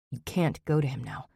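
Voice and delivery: pained. A narrator's reading. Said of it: "so much pain in his voice".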